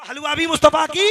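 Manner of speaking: loud emphatic preaching shout; rousing, full-volume punchline; shouted clincher. Real, not acted. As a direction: throw it out loudly to the crowd. Throws the point back at crowd.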